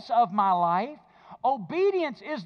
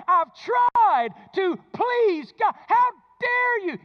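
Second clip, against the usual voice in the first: louder, higher indignant outburst, stretched on please God; retold fury; 8 semitones higher, 7 dB louder than usual. Pastor reliving his anger at his mentor's words, loud and indignant. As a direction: relive the outrage at being told you don't love God. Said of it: "I was furious".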